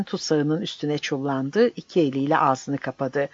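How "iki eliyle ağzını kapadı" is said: ordinary delivery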